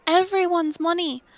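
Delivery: ordinary talk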